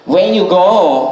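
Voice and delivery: slow, high Thai tuk-tuk driver voice; Thai accent. Retold or imitated. Tuk-tuk driver greeting a tourist in Thai accent. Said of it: "tuk-tuk driver will come up".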